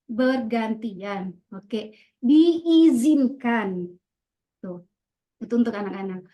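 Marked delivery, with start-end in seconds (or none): 0.1-3.9 bergantian and diizinkan stretched very slowly, weighted; slow emphasis. Shown as a demonstration. Teacher modelling emphatic rule words for pupils, very slow. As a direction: drag each word out deliberately. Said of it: "ada intonasi gitu ya".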